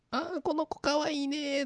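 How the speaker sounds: high, slow cooing voice; cooing cat lady. Retold or imitated. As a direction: coo at the cat like a doting owner.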